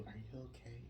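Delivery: imitating a discreet, concerned bystander; soft retold question; hushed concern. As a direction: ask very softly, checking on him. Voices concerned bystanders asking later.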